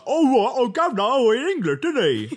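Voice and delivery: put-on high, loud caricature of a British accent; mock British accent. Imitating people who mimic British speakers to their face, hammed-up British accent. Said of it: "to my face be like".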